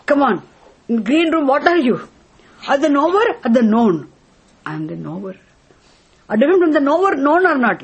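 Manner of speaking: high, loud probing questions; demanding teacher. Acted. Scripture questioning the seeker, high and loud. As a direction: press the student hard.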